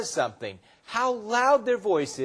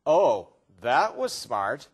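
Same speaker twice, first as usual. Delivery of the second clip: slow, low sarcastic drawl; mock them with fake praise, drawn out; low-pitched, stretched-out sarcasm; 6 semitones lower, 3 dB louder, slower than usual. Shown as a demonstration. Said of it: "they're being sarcastic".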